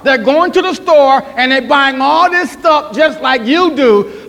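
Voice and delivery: preaching emphasis. Acted. Pastor warning the church about unsaved people, emphatic preaching. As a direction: lean hard on unsaved.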